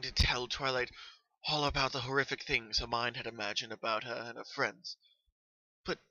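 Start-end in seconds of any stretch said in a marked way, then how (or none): none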